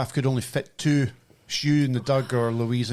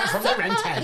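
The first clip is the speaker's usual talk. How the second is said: teasing echo; 12 semitones higher than usual. Retold or imitated.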